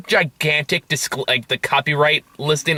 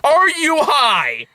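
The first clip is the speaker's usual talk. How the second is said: high, long-drawn disbelief; incredulous, stretched-out question; incredulous outburst; 10 semitones higher, much slower than usual. Retold or imitated. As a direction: drag it out high, in exasperated disbelief. Incredulous reaction to the film.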